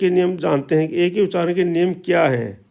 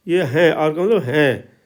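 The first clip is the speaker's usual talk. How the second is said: ordinary talk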